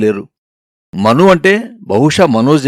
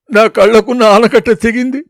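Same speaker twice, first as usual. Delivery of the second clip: high, tearful, voice caught in sobs; breaking into tears; 8 semitones higher than usual. Acted. Girl runs to her grandmother, tearful. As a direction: cry it out into grandma's arms. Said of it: "గట్టిగా ఏడ్చేశాను".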